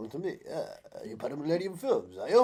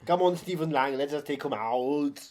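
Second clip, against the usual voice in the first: bad Stephen Lang impression; 3 semitones higher, 7 dB louder, slower than usual. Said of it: "you sound nothing like Stephen Lang".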